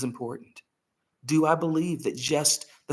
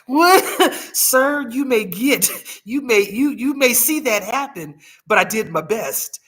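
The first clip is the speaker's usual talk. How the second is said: high, loud, flustered disbelief; incredulous reply; 8 semitones higher, 6 dB louder than usual. Retold or imitated. Daughter answering her hospice dad's grim question, flustered and incredulous. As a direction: sputter in mock shock, pitched well up. Retelling a shocked reply to dad.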